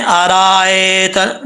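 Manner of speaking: अरायत with the रा and हमज़ा stretched long; the mistake to avoid; wrong long vowel. Shown as a demonstration. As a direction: overlong अरायत. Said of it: "रा को लंबा भी नहीं करना".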